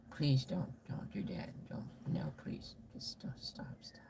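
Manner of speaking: whispered begging that fades away; hushed mock pleading. Audience begging Faith to drop the bad accents, whispered mock plea. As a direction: beg in a tiny, fading whisper.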